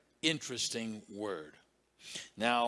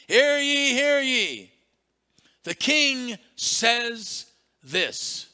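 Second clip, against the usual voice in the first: loud, high slow proclamation; town crier call; 9 semitones higher, 10 dB louder, much slower than usual. Retold or imitated. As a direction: proclaim it to the village. Acting the town crier again.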